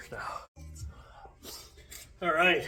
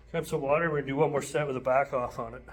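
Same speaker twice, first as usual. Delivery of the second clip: ordinary delivery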